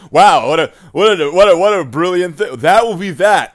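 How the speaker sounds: loud, high wow of delight; mock amazement. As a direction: gush over it, hammed-up. Mock amazement at painted model.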